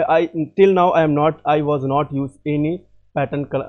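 ordinary talk, nothing marked

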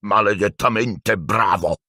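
slow, booming big-man voice; exploding Hagrid. Acted. Hagrid calling above the crowd, slow big voice. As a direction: burst out over the crowd. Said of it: "esplose Hagrid".

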